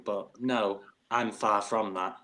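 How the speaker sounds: normal talking voice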